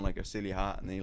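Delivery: ordinary talk, nothing marked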